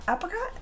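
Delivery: APRICOT with a short A as in apple, rising; questioning "apricot", first vowel as in cat; trying out a pronunciation. Shown as a demonstration. Streamer testing how she pronounces a word, questioning apricot with short A. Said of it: "how did you just pronounce apricots".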